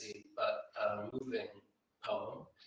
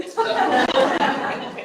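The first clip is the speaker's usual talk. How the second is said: burst of laughter; 14 semitones higher, 11 dB louder than usual. Real, not acted. Laughing at the joke.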